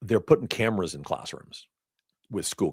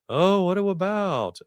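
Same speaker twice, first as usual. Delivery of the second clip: slow raised doctor voice; slow questioning doctor voice; 11 semitones higher, much slower than usual. Retold or imitated. Monotone doctor quoted.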